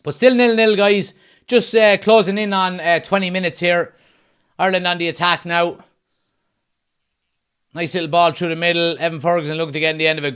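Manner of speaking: loud, high play-by-play; live commentary. Acted. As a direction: call the match live.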